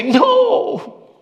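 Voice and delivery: high, loud protest; shouting at Boaz. Retold or imitated. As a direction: yell at the Bible like at a bad play. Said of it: "I do this for my Bible".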